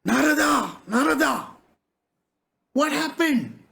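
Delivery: acted call to rouse someone lost in grief. Storyteller as Narayana calling to Narada, calling out.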